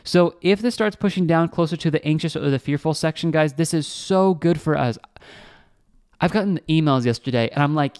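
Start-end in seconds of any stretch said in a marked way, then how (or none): none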